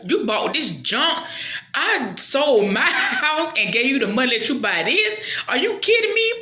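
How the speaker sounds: high, loud, disbelieving rant; mock outraged husband. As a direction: rant at her in comic outrage. Husband angry about bought house.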